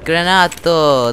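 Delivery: put-on loud, drawn-out Italian accent. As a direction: call it out slowly with an Italian flourish. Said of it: "I put an Italian accent on it".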